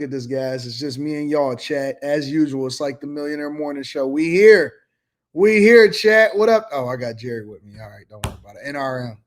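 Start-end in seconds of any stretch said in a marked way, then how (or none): 4.1-4.7 loud hype call to the chat. Real, not acted. Host calling out to chat. 5.3-6.7 louder and higher hype shout; bigger call out. Real, not acted. Host calling out to chat.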